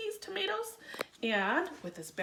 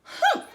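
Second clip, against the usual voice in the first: sudden loud high humph; huff; 10 dB louder than usual. A narrator's reading. McGreely scoffing at the bunnies, loud humph. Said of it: "he huffed".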